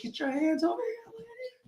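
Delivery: lightly camp call to a friend; sassy sing-song summons; campy drag queen. Acted. Calling a friend over for shots.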